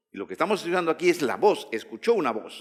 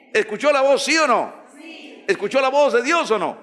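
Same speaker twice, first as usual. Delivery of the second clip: high, loud questions hammered at the church; fiery preaching; 7 semitones higher, 4 dB louder than usual. Real, not acted. Preacher presses the congregation to answer, loud and high. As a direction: demand an answer from the pews.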